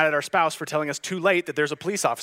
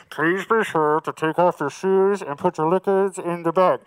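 overhead announcement; 6 dB louder than usual. Retold or imitated.

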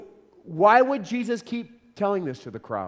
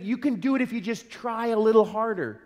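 lightly voiced upbeat encourager, a touch higher; mock self-esteem pep talk; 4 semitones higher than usual. Preacher mocking the self-esteem message Jesus avoids. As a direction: tease the try-harder message. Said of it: "this self-esteem message".

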